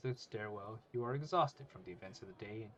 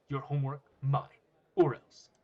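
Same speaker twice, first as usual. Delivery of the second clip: loud, punched short phrases; forceful, loud ultimatum; bullying threat; 4 semitones higher, 11 dB louder than usual. Acted. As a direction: hammer each word as a threat.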